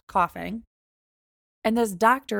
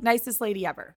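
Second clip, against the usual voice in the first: ordinary delivery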